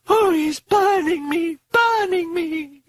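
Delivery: slow, high-pitched wail of pain; moaning. A narrator's reading. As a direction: moan in agony, drawn out and high. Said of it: "Regan was moaning".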